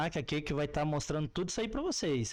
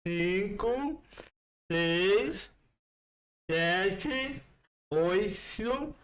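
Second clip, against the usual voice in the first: counting with a pen on the tongue; pen in mouth; 2 semitones higher, much slower than usual. Shown as a demonstration. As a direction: count to ten around a pen. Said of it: "Então vou tentar aqui".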